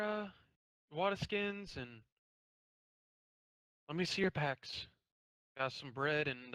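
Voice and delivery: brisk, friendly tavern keeper; innkeeper voice. Acted.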